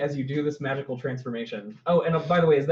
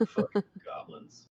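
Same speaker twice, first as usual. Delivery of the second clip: muttering; 8 semitones higher, 6 dB quieter than usual. Acted. Guard mutters as he leaves.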